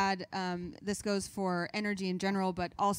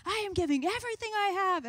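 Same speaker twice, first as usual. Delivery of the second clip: high-pitched overdone on-air energy; giving it everything on camera; hammed-up reporter energy; mock full energy; 12 semitones higher, 3 dB louder than usual. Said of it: "I was like".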